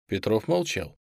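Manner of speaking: ordinary delivery, nothing marked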